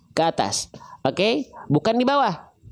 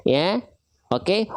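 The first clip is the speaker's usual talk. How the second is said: ordinary talk, nothing marked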